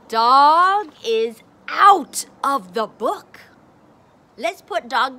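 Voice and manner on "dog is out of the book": gasp, then much louder, slow; mock surprise. Shown as a demonstration. Teacher to child, hammed-up gasp at the dog. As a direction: gasp like it's a big surprise. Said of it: "my turn".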